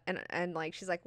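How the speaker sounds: normal talking voice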